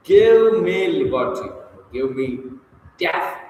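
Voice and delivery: weight every word, pausing before DEATH; stress on each word. Shown as a demonstration. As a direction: GIVE ME LIBERTY high, loud and slow, each word weighted. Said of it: "by stressing each word".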